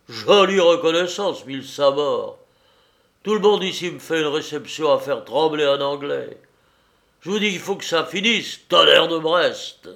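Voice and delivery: jurons criés, voix haute et forte; marin furieux. A narrator's reading. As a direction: tonne de colère en jurant. Le marin jure et menace. (marin furieux qui jure)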